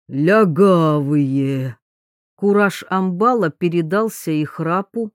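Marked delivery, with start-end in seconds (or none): 0.1-1.8 put-on slow, slurred drunk grunt; a drunk thug hiccuping the word; drunk with hiccups. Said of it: "утробно икнув".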